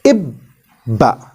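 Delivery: ibba with the b held twice; ibba, b sounded long and doubled. Shown as a demonstration. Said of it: "we will read this combination as".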